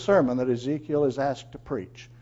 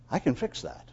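quiet assurance; 2 semitones lower, 6 dB quieter than usual. Acted. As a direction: toss it off softly, as if it were easy. God's easy reassurance, softly voiced.

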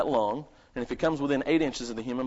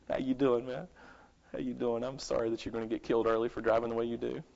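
quick, soft mock-cheerful greeting to a bad driver; hammed-up friendly howdy, muttered fast; big howdy; 4 semitones lower, 6 dB quieter, faster than usual. Pastor greeting the driver who cut him off, mock cheery and quick. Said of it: "I flip on the big howdy".

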